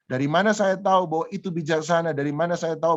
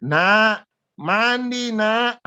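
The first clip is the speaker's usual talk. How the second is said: re-enacted parent's yell, pitched high; mock octave-up shout; high-pitched call; 6 semitones higher, 4 dB louder, much slower than usual. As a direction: holler for the child in a high, held tone. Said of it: "teriak tambah satu oktav lebih tinggi".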